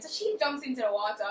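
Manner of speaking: normal talking voice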